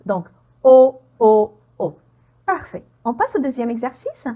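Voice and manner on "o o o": O as a loud, pure "o"; sound O modelled. Shown as a demonstration. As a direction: drill the O loudly, one vowel each time. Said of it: "on prononce O". (O, voyelle « o » bien forte)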